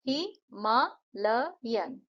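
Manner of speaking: हिमालयन said very slowly, हि-मा-ल-यन; syllable beats. Shown as a demonstration. Teacher counts the beats in हिमालयन. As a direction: draw हिमालयन out in four beats. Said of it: "हिमालयन में चार".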